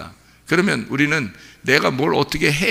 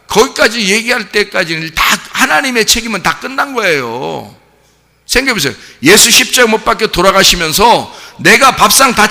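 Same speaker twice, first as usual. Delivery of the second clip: higher, louder climactic sermon; full-force preaching; 5 semitones higher, 7 dB louder than usual. Acted. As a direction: drive the point home to the congregation.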